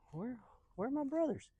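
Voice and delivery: high, puzzled question; puzzled searching. Acted. David searching for his brothers, puzzled. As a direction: wonder aloud, pitched well up.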